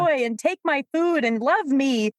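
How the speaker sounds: hammed-up needy plea for the dog; mock needy handler. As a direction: beg for attention, half joking.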